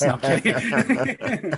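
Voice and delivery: laughing it off, slow; giggling that he's only kidding. Real, not acted. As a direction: chuckle through the retraction. Joking after teasing claim.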